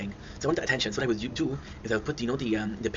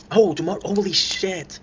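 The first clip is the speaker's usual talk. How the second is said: higher, slower, startled blurt; suddenly remembering, drawn out; sudden realization; 7 semitones higher, 5 dB louder, slower than usual. Real, not acted. Remembering Occupation Double starts tomorrow, startled and slow.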